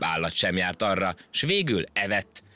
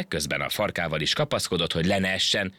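ordinary delivery, nothing marked

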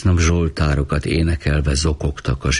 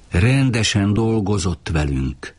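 plain reading voice

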